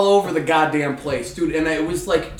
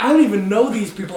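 panicked realization; 6 semitones higher, 5 dB louder, faster than usual. Retold or imitated. Sudden drunk realization.

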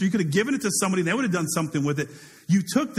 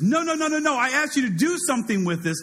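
master going berserk; 7 semitones higher than usual. Retold or imitated. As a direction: blow up at the lazy servant. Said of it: "Master goes berserk".

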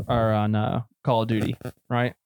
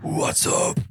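whispered mock metal scream; stage whisper. Shown as a demonstration. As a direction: rasp it out in a whisper. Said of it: "whispering 'cause I can't actually metal scream".

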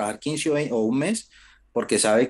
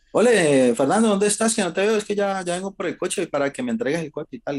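high, loud mock caller fading back down; phone call impression that starts loud and settles; imitating boss on phone; 8 semitones higher, 3 dB louder than usual. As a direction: play the boss calling, eager and pushy. Boss calling on phone, retold.

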